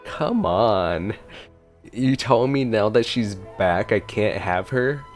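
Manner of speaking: usual speaking voice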